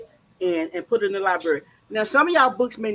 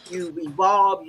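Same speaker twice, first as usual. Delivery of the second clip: ordinary talk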